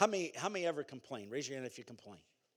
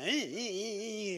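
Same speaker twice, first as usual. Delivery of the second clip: slow, higher mock whine; whining complainer; 4 semitones higher than usual. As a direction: imitate a constant complainer, dragging it out. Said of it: "all they do is complain".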